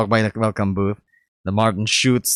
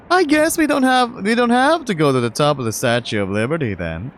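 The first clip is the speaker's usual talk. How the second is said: put-on bright, upbeat young man; eager friend showing off the city; cheerful friend voice; 6 semitones higher than usual. Mark teasing.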